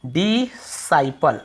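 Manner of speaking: "डिसाइपल" very slowly as di... sai-pal; syllable by syllable. Shown as a demonstration. Teacher answers own question for students, very slow syllables. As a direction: stretch out "डिसाइपल" in separate syllables, one s. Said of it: "इसे हम क्या पढ़ते हैं".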